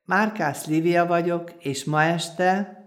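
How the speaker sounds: usual speaking voice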